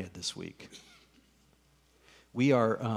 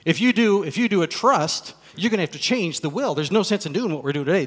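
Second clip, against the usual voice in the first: louder, higher, lecturing attorney; condescending lecture; 6 semitones higher, 8 dB louder than usual. Retold or imitated. Attorney lecturing the couple about trusts, louder and condescending. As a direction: talk down to them, a little faster. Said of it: "That's exactly how he said it, too".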